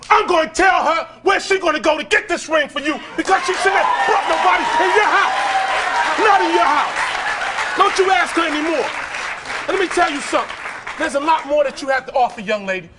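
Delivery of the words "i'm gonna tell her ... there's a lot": furious scolding. Real, not acted. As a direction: lay down the law, full force. Scolding defiant teen on show.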